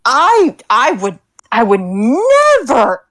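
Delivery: overacted, stop-and-start protest of innocence; putting on a performance. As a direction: ham up the sincerity with drawn-out key words. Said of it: "This is a performance".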